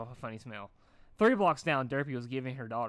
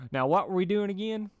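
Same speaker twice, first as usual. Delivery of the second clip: lightly voiced country mare, louder; a hint of a folksy farm pony; Applejack's voice; 8 semitones higher, 7 dB louder than usual. A narrator's reading.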